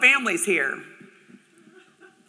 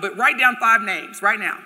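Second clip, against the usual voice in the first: normal talking voice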